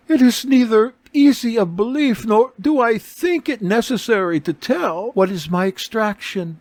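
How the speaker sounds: a noble lady recounting her ruin; beggar woman's account. A narrator's reading.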